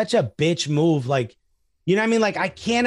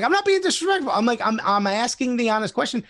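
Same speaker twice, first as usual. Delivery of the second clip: defensive protest; 6 semitones higher than usual. Retold or imitated. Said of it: "And I'm like".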